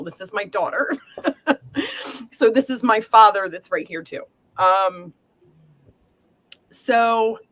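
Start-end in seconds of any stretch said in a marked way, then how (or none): 1.0-2.2 a high, breathy laugh to hold back tears; tearful laugh. Real, not acted. Psychic laughs through tears reading for her daughter. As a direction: laugh through rising emotion.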